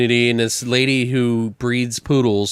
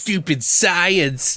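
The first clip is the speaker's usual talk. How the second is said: mock sneer; 6 semitones higher, much slower than usual. Mocking anti-science resident.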